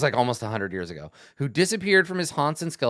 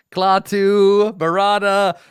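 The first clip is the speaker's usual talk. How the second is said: mock incantation; 7 semitones higher, 8 dB louder, slower than usual. Jokey movie incantation.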